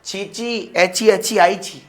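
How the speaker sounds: put-on high, loud childish lisping call to mummy. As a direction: call out like a little kid who needs help. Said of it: "तुमने बोला".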